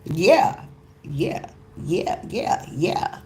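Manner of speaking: normal talking voice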